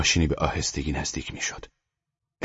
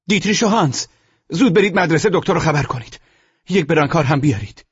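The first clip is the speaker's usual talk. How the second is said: high, loud commands; urgent shouted orders; 13 semitones higher, 8 dB louder than usual. Acted. Narrator sending two comrades for a doctor, shouting. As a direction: send them running with a shout.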